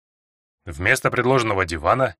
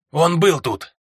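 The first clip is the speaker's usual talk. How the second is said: raspy, worn-out hoarse voice; hoarse and exhausted, higher; 4 semitones higher, much slower than usual. A narrator's reading. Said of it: "сиплым голосом".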